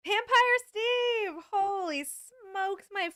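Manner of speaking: high, slow, drawn-out cry of delight; excited greeting. Real, not acted. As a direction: call the name out, thrilled. Thrilled greeting to a viewer.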